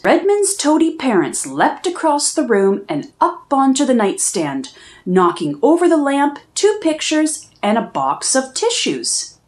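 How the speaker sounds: ordinary delivery, nothing marked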